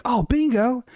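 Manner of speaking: voiced high and sing-song as chat; high mock chat voice. Chat member teasing the streamer, high sing-song mock voice. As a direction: pitch it way up, teasing. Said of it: "You're like".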